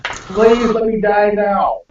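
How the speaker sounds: normal talking voice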